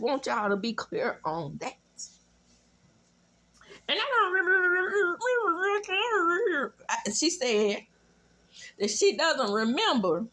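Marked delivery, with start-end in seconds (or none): 3.9-6.7 mock crying. Retelling her tearful confession in a mock crying voice. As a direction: whine it out like she's about to cry.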